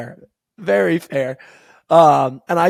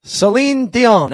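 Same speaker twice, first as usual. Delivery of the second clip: put-on raised, stretched French-accented introduction; French-accented announcer; 5 semitones higher, 6 dB louder than usual. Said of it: "do it with a nice French accent".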